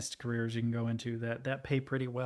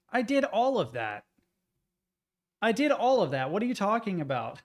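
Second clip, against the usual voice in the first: raised, frustrated disbelief; indignant, higher and louder; exasperated retort; 6 semitones higher, 4 dB louder than usual. Retold or imitated. Exasperated retort to automated system.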